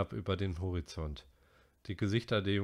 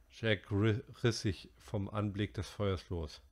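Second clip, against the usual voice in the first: ordinary delivery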